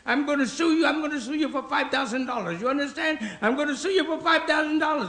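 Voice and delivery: high-pitched, indignant mock rant; angry old man. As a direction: play an outraged man threatening to sue. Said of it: "He was so angry".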